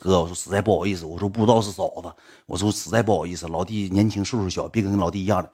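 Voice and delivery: soft, low, grovelling sorry; humble apology. Retold or imitated. Young man apologizing to the big brother, soft and meek.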